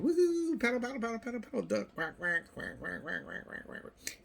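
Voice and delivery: very high, loud woohoo and paddle chant, then quacks fading to a voiceless whisper; gleeful child. Retold or imitated. Kid on the paddle boat, squealing woohoo then quacking and fading out. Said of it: "You're just saying".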